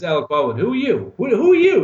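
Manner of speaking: high, rising incredulous challenge; Ed Harris challenging. Retold or imitated. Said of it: "Ed Harris says".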